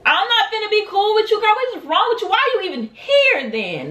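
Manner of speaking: high-pitched, incredulous refusal; indignant retort. Retold or imitated. Storyteller refusing to be cool with the girl, indignant. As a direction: fire back in high indignation.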